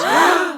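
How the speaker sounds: sharp high gasp; group gasp. A narrator's reading. Pimps gasping at the church aunt, a sharp shocked gasp. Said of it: "The Pimp Coalition gasped".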